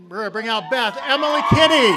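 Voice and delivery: loud, high guest intro. Real, not acted. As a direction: announce her big. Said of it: "A little louder on that".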